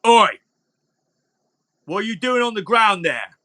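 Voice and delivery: high-pitched guard hailing someone; guard challenge. Acted. Game master as guard spotting Thorin on the ground, calling out. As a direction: call out at a culprit spotted. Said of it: "So he looks down, he sees you".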